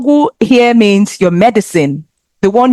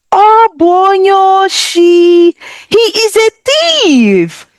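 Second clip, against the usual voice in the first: calling out thief; 10 semitones higher than usual. Acted. Scene: someone spotting a thief running away, high alarmed call. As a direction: raise the alarm, much higher. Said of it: "We just say".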